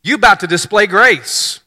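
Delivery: put-on high, loud, breezy hey girl; casual angel. Preacher voicing the angel casually to Mary, high and loud. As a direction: greet her like a buddy. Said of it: "here's what he says to her".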